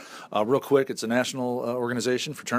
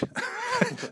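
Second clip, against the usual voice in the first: high laugh; 6 dB quieter than usual. Real, not acted. Laugh after jerk joke.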